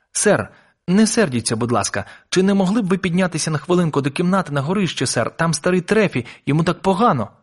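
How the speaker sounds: put-on high, anxious boy's request; pleading young boy. A narrator's reading. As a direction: beg the doctor politely for help.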